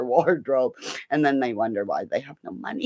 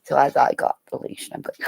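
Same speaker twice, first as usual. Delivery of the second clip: normal talking voice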